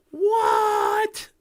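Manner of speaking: high, loud fake-astonished shout; hammed-up gasp of surprise; mock shock. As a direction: feign total disbelief, loud and high. Mock shock at known news.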